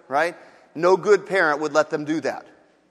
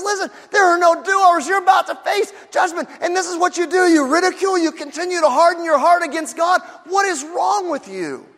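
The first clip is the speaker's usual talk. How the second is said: higher and louder, hammering the scolding; shouted warning; 10 semitones higher, 3 dB louder than usual. Acted. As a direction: call him out at full voice. Criminal scolds his friend.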